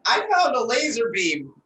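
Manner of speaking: loud, high, menacing threat; high threatening voice. Acted. New choice on threat.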